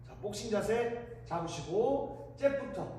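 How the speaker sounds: rhythmic shouted workout calls; boxing coach calls. Shown as a demonstration. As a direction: count the punches like a coach.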